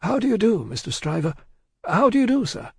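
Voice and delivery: high, polite, measured greeting; prim high-pitched courtesy; discreet sample tone. A narrator's reading. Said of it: "in a sample tone of the voice he would recommend".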